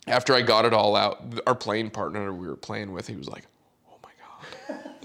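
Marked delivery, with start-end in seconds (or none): 3.9-5.0 slow, voiceless mock whisper; stunned whisper. Playing partner reacting to the blow-up, stunned whisper. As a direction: imitate an aghast onlooker under the breath.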